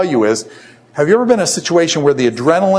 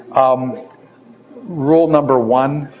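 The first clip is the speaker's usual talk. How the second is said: ordinary delivery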